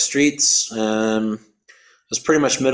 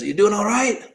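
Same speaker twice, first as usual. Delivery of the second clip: high-pitched Australian guy checking in; Australian passer-by asking upward; Australian accent; 9 semitones higher than usual. Retold or imitated. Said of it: "You're Australian?".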